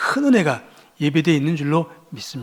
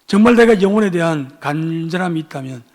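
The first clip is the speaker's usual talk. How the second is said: ordinary talk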